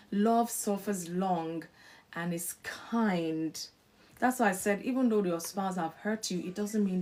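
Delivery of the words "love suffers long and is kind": slow, measured Bible reading; scripture reading. Acted. Preacher reading First Corinthians to couples, slow and solemn. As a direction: lean on each word of the verse.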